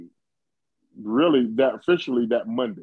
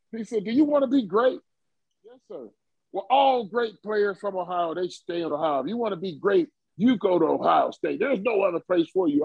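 a hammed-up old coach scolding, higher; mock-furious recruiter lecturing a stubborn kid; Woody Hayes chewing him out; 8 semitones higher than usual. Coach berating a high-school recruit on the phone, hammed-up higher scolding. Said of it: "He's cursing me out".

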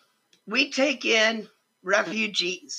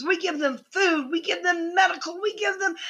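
choked up; 5 semitones higher, 4 dB quieter than usual. Real, not acted. Emotional rant about refugees.

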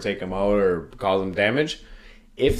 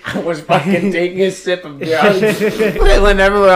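high, loud laughing recount; laughing so hard he can barely tell it; 9 semitones higher, 7 dB louder than usual. Real, not acted. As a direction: crack up while telling it. Laughing at the cheese story.

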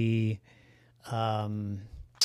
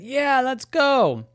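high slow goose voice; 12 semitones higher, slower than usual. Acted. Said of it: "And Ricardo said".

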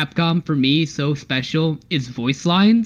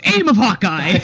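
mock Taskmaster line; 4 semitones higher, 3 dB louder than usual. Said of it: "he goes".

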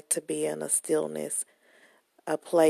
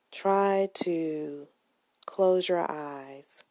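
guided meditation. Acted.

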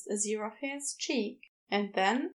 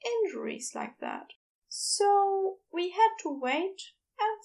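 higher, gentle Aziraphale explaining; higher Aziraphale telling Crowley what happened; 7 semitones higher than usual. A narrator's reading. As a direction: raise the pitch and soften the bad news.

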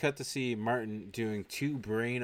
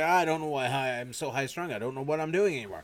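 higher, louder mock exasperation; pissed off; 5 semitones higher, 4 dB louder than usual. Doctor asked about married life, mock pissed off. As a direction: imitate a worked-up man, speeding up at the end. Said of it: "he gets all pissed off".

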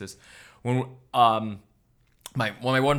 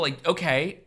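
ordinary delivery

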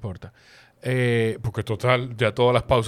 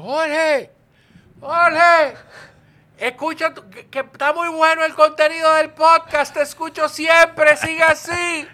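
high, loud shout from a passing car; fan yelling from a car; 8 dB louder, slower than usual. Retold or imitated. A fan shouting praise from a passing car, high and loud. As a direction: call out far higher and louder, like a stranger hollering praise from a car window. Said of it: "bajó su grito y me dijo".

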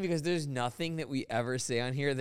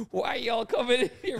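put-on Southern accent; 9 semitones higher, 3 dB louder than usual. Joking as a raided man with a Southern accent, high protest. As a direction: play an upset guy with a Southern accent. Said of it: "a southern accent".

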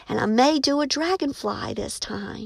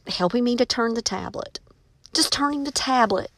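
usual speaking voice

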